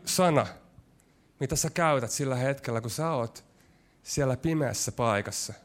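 usual speaking voice

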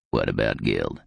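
very low, croaky question; low, aged growl; croaky old man. A narrator's reading. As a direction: ask it deep, like a frail old convict. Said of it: "croaky voice".

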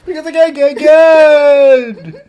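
loud, slow, sung-out shout; third belted take. Pretend. Belting the punchline a third time, drawn out. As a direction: belt it like a stage finale. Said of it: "Say it again".

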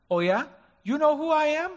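mock-tough braggart; boastful challenge. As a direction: puff up like a bully. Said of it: "he didn't say that".

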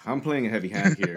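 normal talking voice

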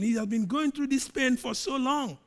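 normal talking voice

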